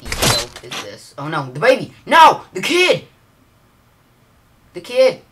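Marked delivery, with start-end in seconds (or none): 1.2-5.2 high, loud panicked screams; panicked screaming. Real, not acted. Gamer watching the kid in danger, panicked yelling. Panic over baby in game.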